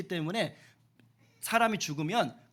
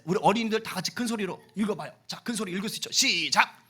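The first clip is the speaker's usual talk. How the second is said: ordinary talk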